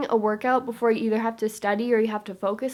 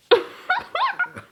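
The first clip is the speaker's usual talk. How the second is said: 1 high laugh. Real, not acted. Laughing at herself while reading.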